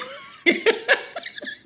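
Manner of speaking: breathy high-pitched burst of laughter. Real, not acted. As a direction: let a squealing laugh out.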